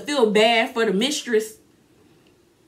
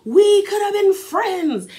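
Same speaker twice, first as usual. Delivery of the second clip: high, slow, hammed-up wounded whine; mock-pitiful Margaret voice; 9 semitones higher, 4 dB louder, slower than usual. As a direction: mimic her hurt plea, dragged out and high. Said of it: "Margaret gets mad and she was like".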